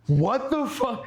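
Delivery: high-pitched, incredulous reenactment; stunned disbelief. Retold or imitated. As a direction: relive being floored, voice leaping way up. Retelling their stunned reaction, laughing.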